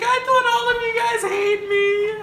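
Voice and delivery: very high, hammed-up squeal; exaggerated high-pitched disbelief; mock overwhelmed wail. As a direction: squeal it as if overcome at winning. Acting out own overwhelmed reaction to winning.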